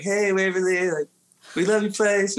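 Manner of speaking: high, sing-song fan voice; high put-on pleading to the restaurant; mock eager message. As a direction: read the DM like a gushing fan. Retelling his Instagram message.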